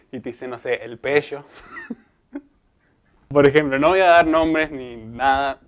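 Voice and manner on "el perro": put-on San Carlos accent, rr softened to a sh-like "pello"; dragged Mendoza R. Showing how San Carlos locals talk, Mendoza accent. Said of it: "arrastran más la R".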